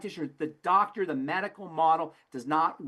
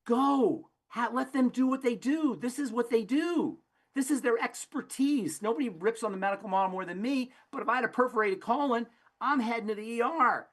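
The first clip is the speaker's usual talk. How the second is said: firm retold advice; 7 semitones higher than usual. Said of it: "I said".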